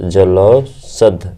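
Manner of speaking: each syllable stretched slowly; slow, drawn out. Shown as a demonstration. Teacher slowly dictating the word to students, drawn out. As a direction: spell it out slowly for the class. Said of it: "क्या हो जाएगा आपका?".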